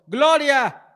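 high shouted hallelujah; praise shout. Acted. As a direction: cry it out like a preacher. Mock religious cry.

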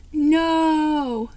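a high, loud, shocked "No!". Acted. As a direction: cry out in sudden dismay.

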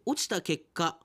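ordinary talk

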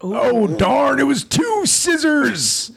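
high, drawn-out mock sports call; play-by-play commentator. Commentator calls a rock-paper-scissors tie, high excited play-by-play. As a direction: cry out the tied round like a commentator.

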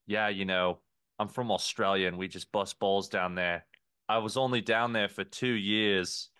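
waiter's put-on Aussie explanation; fake Australian accent. Waiter explaining his roots in a fake Australian accent. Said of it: "definitely a fake Australian accent".

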